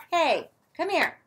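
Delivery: high, loud call to an animal; real call, high and loud. Real, not acted. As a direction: call the animal over across the barn. Calling animal in the barn.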